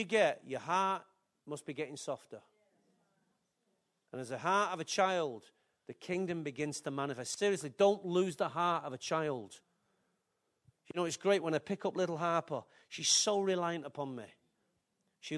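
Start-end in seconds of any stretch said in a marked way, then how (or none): none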